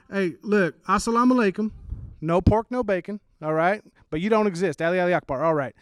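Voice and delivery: a confused Southern local, hammed up. Small-town neighbor puzzling over the family, Southern accent. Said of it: "They would look at my family and go".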